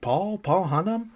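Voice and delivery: mock surprised greeting, pitch lifted; imitated surprised recognition. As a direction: act out spotting an old acquaintance. Acting out a chance recognition.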